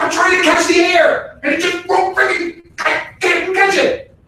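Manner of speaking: put-on high, whiny kid voice; frustrated child. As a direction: whine it like a child chasing air. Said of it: "They're like".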